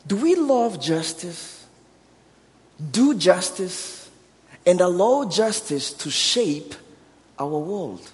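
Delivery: normal talking voice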